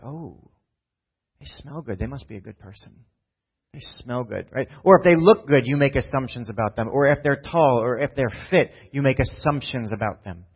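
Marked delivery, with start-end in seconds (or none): 0.0-4.5 hushed mock admiration. Mocking shallow judgment.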